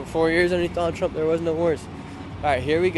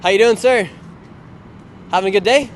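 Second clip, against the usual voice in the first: louder, upbeat street-host hello; loud greeting; 4 semitones higher, 7 dB louder than usual. Real, not acted. Vlogger greeting a man on the street, loud and upbeat. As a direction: call out cheerfully to a passerby.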